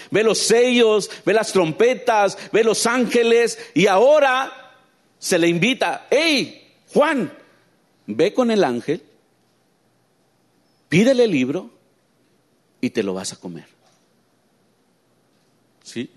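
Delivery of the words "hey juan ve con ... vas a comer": angel's call. Retold or imitated. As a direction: summon John urgently. La voz invita a Juan. (llamado alto del ángel)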